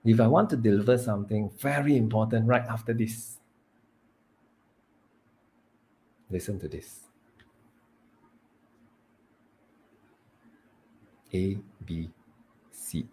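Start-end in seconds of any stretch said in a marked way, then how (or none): 2.5-13.1 hushed after this, long silence before A, B, C; A, B, C spaced out quietly after a pause; long dramatic pauses. Shown as a demonstration. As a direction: hold the silence, then softly give A, B, C. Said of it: "I use the pause".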